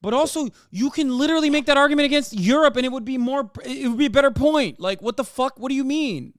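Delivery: rising fury at the absurdity; incredulous ranting, voice climbing high; indignant outrage. Real, not acted. Outraged rant about British hypocrisy.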